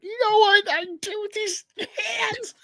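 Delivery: very high laughing cry-voice; hammed-up crying Tobey Maguire; Tobey Maguire impression. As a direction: wail it through laughter like a melodramatic hero. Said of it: "Tobey Maguire's like".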